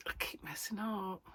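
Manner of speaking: laughing whisper. Real, not acted. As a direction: hush it with a little laugh. Laughing at own mistakes.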